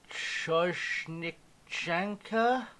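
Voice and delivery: a slow, questioning guess at Choshnichanka; unsure pronunciation. Shown as a demonstration. Struggling with a foreign team name, slow guess. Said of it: "I can't pronounce that".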